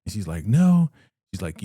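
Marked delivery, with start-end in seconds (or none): 0.5-0.9 high, loud outraged no; sharp refusal. Retold or imitated. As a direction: bark the no up high. Joan refusing Andrew.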